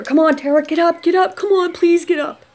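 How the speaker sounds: high, loud retold begging; urgent pleading. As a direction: imitate someone desperately rousing a friend. Retold voices rousing her as she is shaken.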